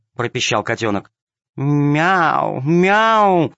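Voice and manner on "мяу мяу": ingratiating meow. A narrator's reading. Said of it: "замяукала вкрадчиво".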